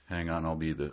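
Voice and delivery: usual speaking voice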